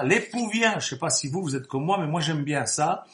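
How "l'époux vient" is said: loud proclamation. Acted. Preacher crying out the herald's call to the sleepers, loud and high.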